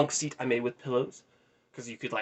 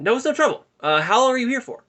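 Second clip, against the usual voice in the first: high, louder small-talk voice; Justin; 12 semitones higher, 6 dB louder than usual. Retold or imitated. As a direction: imitate an eager, oblivious chatterer.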